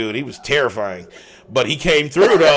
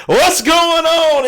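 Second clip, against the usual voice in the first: hyped greeting; 13 semitones higher, 5 dB louder, much slower than usual. Acted. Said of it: "host's big show greeting".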